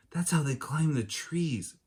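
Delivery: ordinary delivery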